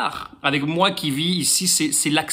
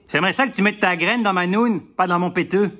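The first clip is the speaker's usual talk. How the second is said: put-on Québécois accent. Québécoise girlfriend to her lover, mock Québécois accent. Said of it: "La même phrase au Québec".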